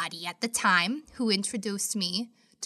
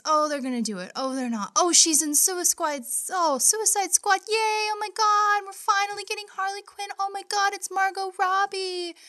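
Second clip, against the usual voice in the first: excited fan chatter; 9 semitones higher, 4 dB louder than usual. Retold or imitated. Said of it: "hearing whispers".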